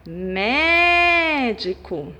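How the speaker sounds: médico with MÉ held very long; MÉÉÉ-dico, sustained opening; stressed syllable exaggerated. Shown as a demonstration. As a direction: stretch the first syllable of médico, then let the rest fall. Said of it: "é a antepenúltima".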